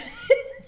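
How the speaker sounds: squealing laugh; sudden high giggle; high burst of laughter. Real, not acted. Laughing at eating alone idea.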